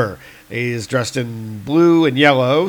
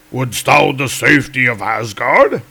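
Volstagg voice; 3 dB louder than usual. Retold or imitated. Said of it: "asks Volstagg".

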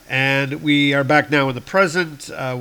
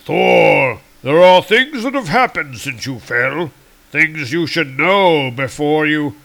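commanding; 3 semitones higher, 6 dB louder than usual. Acted. Odin interrupts Thor urgently.

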